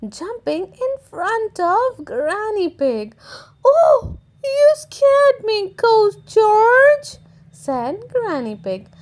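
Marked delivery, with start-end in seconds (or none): 3.6-7.2 high, loud pretend scare; mock fright. A narrator's reading. Granny pretending the ghost scared her, high and loud. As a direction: play startled for the children.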